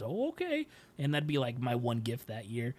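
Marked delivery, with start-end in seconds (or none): 0.0-0.7 high mock mom agreeing. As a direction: answer lightly like his mom. Mom's reply in Christmas story.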